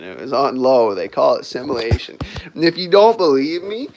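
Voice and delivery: normal talking voice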